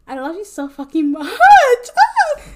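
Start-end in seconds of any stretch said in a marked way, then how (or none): 1.4-2.4 high, loud laughing shriek; a giddy shriek of embarrassment; laughing squeal. Real, not acted. Flustered by compliments.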